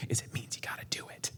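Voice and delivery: hushed, voiceless whisper; whispered aside. Real, not acted. Preacher confiding a hard truth to the congregation, whispered. Sermon point dropped to whisper.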